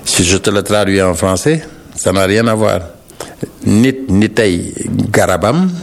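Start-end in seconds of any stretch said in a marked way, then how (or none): 3.7-5.7 slow, solemn Nit nitay garabam; recited proverb. Shown as a demonstration. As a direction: intone the proverb slowly. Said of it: "un proverbe wolof qui dit".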